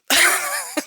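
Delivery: high rising giggle. Real, not acted. Coach laughing at herself, high giggle. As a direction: break into a high little giggle. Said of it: "I'm laughing at me".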